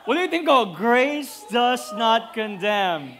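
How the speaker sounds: grace does not condemn, said slowly and loudly, word by word; slow, loud preacher's repeat; slow emphatic repeat. Acted. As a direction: drive home each word to the crowd. Repeating the point to the crowd.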